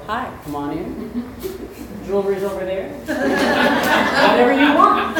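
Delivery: slow, lightly voiced friendly dog, laughing at the end; a mild hint of a happy host inviting a thief in; dog playing welcoming host. German Shepherd greeting a stranger at the door, mock friendly welcome. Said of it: "he'd go".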